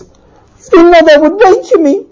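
high, loud, drawn-out disbelief; incredulous outrage. Acted. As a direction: throw the words back, pitched high. Husband throws her words back.

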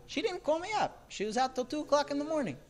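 high-pitched mock grievance; indignant husband, acted.

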